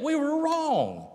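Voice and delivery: high, slow, drawn-out admission; confessing. Acted. Brothers admit their sin against Joseph, impassioned confession. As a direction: draw out the confession, pitched well up. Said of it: "they confess, they admit".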